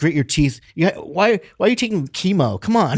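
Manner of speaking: loud, high, goading pep talk; hammed-up scolding exhortation; mock tough love. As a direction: egg them on like a pushy hard-liner, jokingly. Mock tough-love to cancer patient.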